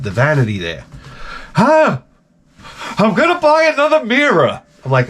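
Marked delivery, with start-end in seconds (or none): 1.5-4.6 high, mock whiny wife. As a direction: squeak it out like his wife. Wife voiced in story.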